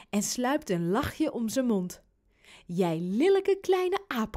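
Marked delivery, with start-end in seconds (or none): 2.7-4.3 higher, slow teasing scolding; mock-outraged call. A narrator's reading. Dad playfully scolding his prankster son, mock outrage. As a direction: draw out the playful insult. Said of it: "roept hij".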